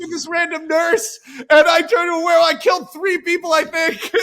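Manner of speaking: loud, high, blurted confession. Retold or imitated. David to his ex on the phone, loud blurted confession. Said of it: "He's like".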